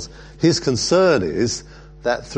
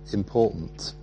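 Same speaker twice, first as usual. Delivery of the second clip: usual speaking voice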